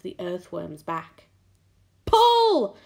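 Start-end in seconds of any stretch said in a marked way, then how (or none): 2.0-2.8 high, loud, long command; shout. A narrator's reading. James ordering the tug on the earthworm, shouting. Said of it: "shouted James".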